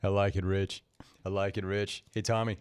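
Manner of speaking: a hint of Rich's voice; mimicking Rich. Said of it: "still talking with Rich's voice".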